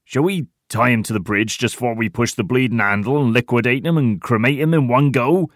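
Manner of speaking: delighted. A narrator's reading. Tiny proposes how to get rid of Heidi, gleeful. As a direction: relish the wicked plan. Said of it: "suggests Tiny delightedly".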